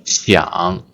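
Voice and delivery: 想 low and dipping; third tone. Shown as a demonstration. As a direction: drop 想 to the bottom of the voice. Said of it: "Third tone".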